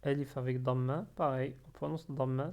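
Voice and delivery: ordinary delivery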